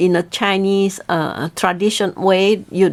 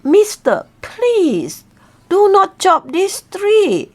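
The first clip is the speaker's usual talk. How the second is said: much higher, slow begging bird voice; pleading eagle; 9 semitones higher, slower than usual. Acted. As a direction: beg him slowly, small and high. Eagle begs.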